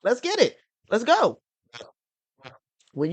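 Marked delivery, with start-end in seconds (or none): 0.0-1.4 higher, louder hype call. Acted. Host rallying the co-hosts to start, hyped. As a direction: pump everyone up to start. Hyping up the porch.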